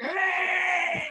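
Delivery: very high, loud, drawn-out imitated yell; Johnny Haidt yelling. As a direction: let rip with the friend's long holler. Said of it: "he yells".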